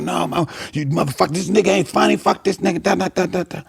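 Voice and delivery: high-pitched mock rant; heckler's tirade. Heckler grabbing the comic's mic, high-pitched mock tirade. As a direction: rant high, tailing into da-da filler.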